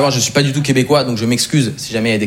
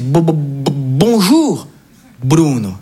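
mock stammering emotion; much slower than usual. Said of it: "il est tout ému".

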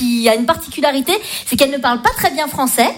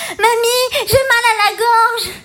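put-on much higher, slow little child's complaint; whiny child; 10 semitones higher, much slower than usual. Little girl telling grandmother her throat hurts, whiny child voice. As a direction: whine like a sick kid. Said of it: "j'ai dit à ma grand-mère".

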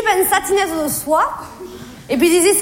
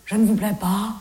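low-voiced rejected suitor; [name]; 6 semitones lower than usual. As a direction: sink lower as a man turned down. (voix grave de soupirant éconduit)

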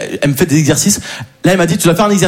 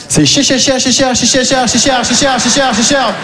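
rapid, chanted repeat of "cher"; mock stutter; 3 semitones higher, slower than usual. Patient reacting to the session's price, hammed-up stuck repetition. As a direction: get stuck repeating "cher" in an even higher-pitched run.

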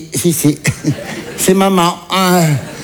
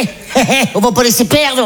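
higher greedy mum voice; mother's voice; 5 semitones higher than usual. Retold or imitated. Mother grabbing leftovers at restaurant, high greedy voice. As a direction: chirp it like a hungry mum. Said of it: "elle a fait".

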